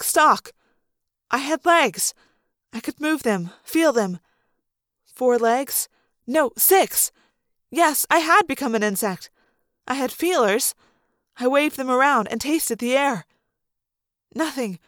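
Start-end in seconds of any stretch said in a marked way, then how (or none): none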